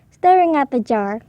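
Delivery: ordinary delivery, nothing marked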